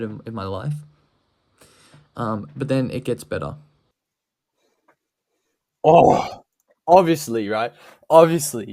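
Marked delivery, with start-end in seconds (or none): 5.8-8.7 loud mocking repeat. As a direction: parrot it loudly with scorn. Mocking the clip's repeated word.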